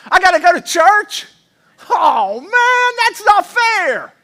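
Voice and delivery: put-on high, loud, drawn-out child's whine; whining kid. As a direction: whine like a kid dragged to church. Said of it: "a kid in here this morning that even said it".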